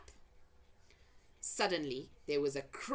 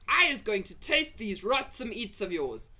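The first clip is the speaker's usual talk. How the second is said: loud, higher menacing giant voice; threatening giant; 7 semitones higher, 6 dB louder than usual. A narrator's reading. Blood bottler warning the BFG, loud and menacing. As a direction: threaten him, voice raised.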